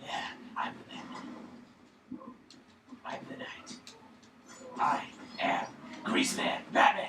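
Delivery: breathy whispered vigilante voice; whispered Batman parody. Pretend. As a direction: brood in a hushed, mock-serious voice.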